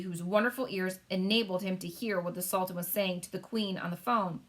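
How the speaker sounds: plain reading voice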